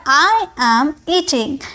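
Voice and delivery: "I am eating" drawn out slowly, word by word; slow model sentence. Shown as a demonstration. Teacher shows the class how to say it, slowly. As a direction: say "I am eating" slowly so students can copy. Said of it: "क्या लिखा हुआ है?".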